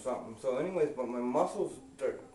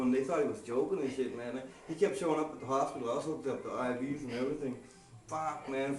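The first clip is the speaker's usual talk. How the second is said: normal talking voice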